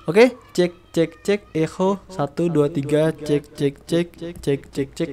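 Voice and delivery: mic echo effect. Shown as a demonstration. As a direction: cek with reverb trailing. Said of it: "gua bakalan tes dari echo-nya".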